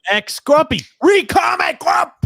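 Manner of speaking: high mock shout of a nickname. Real, not acted. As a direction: bellow the nickname, drawn out.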